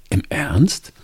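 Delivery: surprised. A narrator's reading. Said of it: "sage ich erstaunt".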